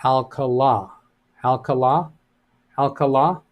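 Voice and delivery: "Alcala" ending on a stressed, increasingly questioning "la"; stress on last syllable. Shown as a demonstration. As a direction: test "Alcala" with the final "la" stressed. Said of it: "That gives an emphasis on the last".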